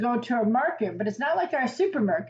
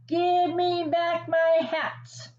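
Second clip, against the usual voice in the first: higher, slow mock shout; 6 semitones higher, slower than usual. Hat seller shouting up at the monkeys, mildly hammed. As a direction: call up to the trees, hammed up but gentle. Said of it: "put his hands to his mouth and shouted".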